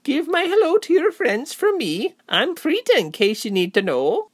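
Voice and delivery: very high-pitched cheerful woman; bubbly hostess in a high voice; cheerful chatter. A narrator's reading. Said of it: "the woman chattered on cheerfully".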